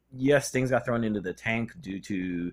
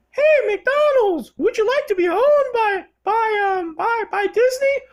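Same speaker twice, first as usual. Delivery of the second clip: very high, squealing cartoon-mouse voice; Disney's mascot mouse trying to buy out McDonald's in a falsetto; squeaky Disney mouse; 5 dB louder than usual. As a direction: wheedle and coax like a greedy cartoon mouse. Disney as mouse pitching takeover.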